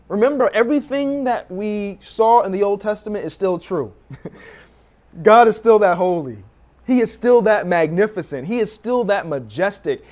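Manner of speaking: loud, driving preacher's emphasis; preaching at full force. Acted. Preacher to congregation, loud and driving. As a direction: proclaim God's holiness to the congregation.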